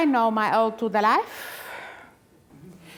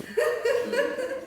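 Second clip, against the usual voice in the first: a high cackling laugh after the threat; a short wicked giggle; sinister high laugh; 11 semitones higher, 6 dB quieter than usual. Acted. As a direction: let a thin high laugh escape.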